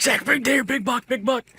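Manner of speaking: high, strained and excited; urgent alert. Retold or imitated. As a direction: re-enact a frantic heads-up to a hunting partner. Urgent alert to hunting partner.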